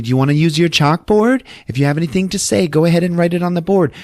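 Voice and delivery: high, quickening singsong request; coaxing a spirit. Retold or imitated. Medium asks Louis to use his chalkboard, high and coaxing. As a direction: call up brightly to someone unseen.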